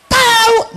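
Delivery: "Tahu" high and loud, Betawi style; Betawi intonation. Acted. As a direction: throw out "Tahu" as a loud Betawi exclamation meaning no idea. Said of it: "tuh intonasinya beda". ("Tahu" dengan intonasi Betawi, tinggi dan kencang)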